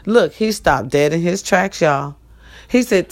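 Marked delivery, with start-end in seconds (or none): none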